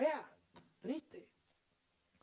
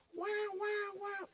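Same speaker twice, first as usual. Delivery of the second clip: high wailing siren sound, much higher; siren imitation; much slower than usual. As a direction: mimic a fire-truck siren with the voice. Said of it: "que parecían los bomberos".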